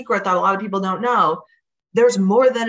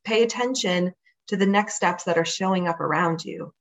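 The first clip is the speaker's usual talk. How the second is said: guided meditation. Acted. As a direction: guide them gently into calm. Leads a visualization.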